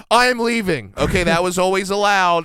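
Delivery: loud, high exasperated declaration; mock-dramatic walkout shout; acted outburst. Acting out a shouted cartoon breakup.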